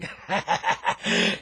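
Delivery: a wheezing, nearly silent stoner giggle; airless giggle. Acted. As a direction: laugh through the teeth at your own joke. Stoner host laughs at own joke.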